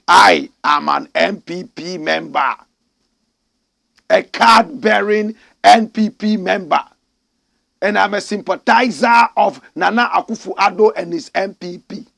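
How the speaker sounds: imitating a boldly proud party man; loud, mock-proud announcement; bold declaration. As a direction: declare it boldly, as if to a whole nation. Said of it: "can boldly tell the whole nation".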